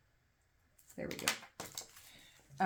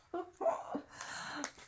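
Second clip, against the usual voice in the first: high breathy laugh; 14 semitones higher than usual. Real, not acted. Laughing at own worry.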